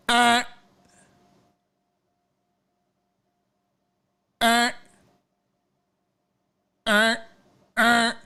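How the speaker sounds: mimicked key fob chirps, high; car alarm chirps. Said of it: "and you hear".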